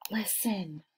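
urgent hushed whisper. Acted. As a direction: hiss it so the teacher won't hear. Kids hushing a classmate.

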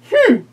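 a high, loud thinking hmm; high witch hum. Acted. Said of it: "said the witch".

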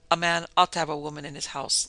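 ordinary delivery, nothing marked

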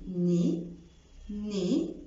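ني ending on the vowel; silent final z. Shown as a demonstration. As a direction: drop the z. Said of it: "مش بقول". (ني من غير z)

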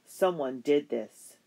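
father finding the sabotaged canoe, sharp and grim; sharp accusation. Acted. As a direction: bite each word out slowly. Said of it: "Papa's voice, sharp as a skinning knife".

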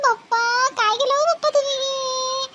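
high, drawn-out mock wail; theatrical wailing. Daughter laments her marriage to absent Papa, wailing high and slow. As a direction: stretch the words into a plaintive, rising lament.

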